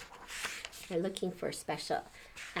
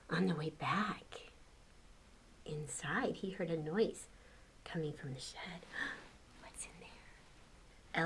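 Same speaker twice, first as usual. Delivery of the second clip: softer, whispered suspense; hushed suspense; 4 semitones lower, 11 dB quieter than usual. A narrator's reading. Reading the shed scene to kids, hushed suspense. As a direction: draw them in with a spooky hush. Suspense part of bedtime story.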